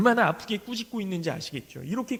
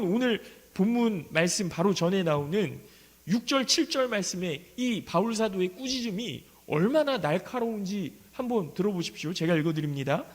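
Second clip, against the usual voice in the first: ordinary delivery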